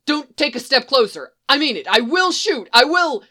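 high and loud, rising in panic; cornered scientist threatens troopers, voice climbing in panic; desperate threat. Acted. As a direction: bluff bravely while terrified. Scared scientist threatens with blaster.

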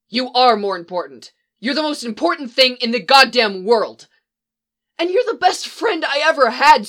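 furious, desperate confession; heated confession. Acted. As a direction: yell it with raw emotion. Angry love confession.

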